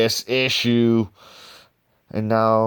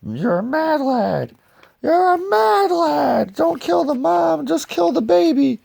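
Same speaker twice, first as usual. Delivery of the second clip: much higher, louder squeaky lunatic voice; crazed character voice; 5 dB louder than usual. Retold or imitated. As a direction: play a gleeful maniac egging him on.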